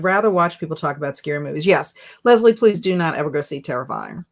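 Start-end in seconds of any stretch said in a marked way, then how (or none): none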